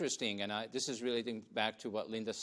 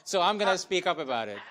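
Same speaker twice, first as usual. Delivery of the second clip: louder and higher, pushing to be heard; speaking up without a mic; 7 semitones higher, 9 dB louder than usual. Real, not acted. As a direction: raise the voice over a bad mic. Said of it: "I'm getting angry that I can't get the".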